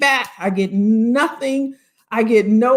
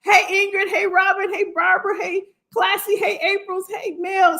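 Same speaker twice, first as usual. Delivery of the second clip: cheery greetings; 8 semitones higher than usual. Pretend.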